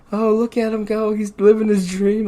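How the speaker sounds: high-pitched gushing imitation; emotionally moved wife. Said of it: "she gets so emotionally involved with these things".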